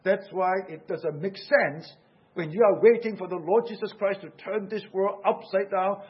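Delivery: ordinary talk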